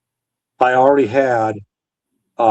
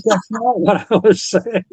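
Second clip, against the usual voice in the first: high, slow, taken-aback denial; flustered protest; 10 semitones higher, slower than usual. Retold or imitated. Telling the boss he misread the refusal, startled and high. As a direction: pitch up in startled protest, drawn out. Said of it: "And I'm like".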